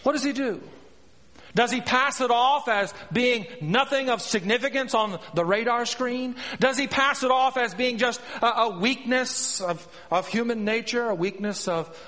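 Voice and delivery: impassioned preaching. Real, not acted. Preacher asking how the king handles his sin, impassioned. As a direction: press the congregation with pointed questions.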